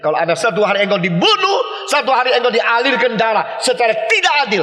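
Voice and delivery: fervent preaching. Real, not acted. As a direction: proclaim it with full conviction. Preacher climaxes on martyr hope.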